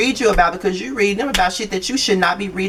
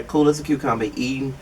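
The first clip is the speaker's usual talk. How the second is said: usual speaking voice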